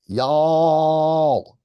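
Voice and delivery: drawn-out, high Y'ALL; calling out. Shown as a demonstration. Demonstrating the hollered Southern word.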